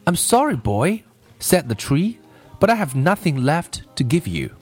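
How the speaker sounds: measured storybook reading with lilting pitch; story read-aloud. A narrator's reading. Teacher reading a story for listening practice, storytelling. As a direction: read it like a gentle picture-book tale.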